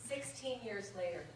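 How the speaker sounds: measured, rhythmic verse reading; comic poem read to an audience. Acted. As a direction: read the light verse line by line, savouring rhymes. Reads a comic poem aloud.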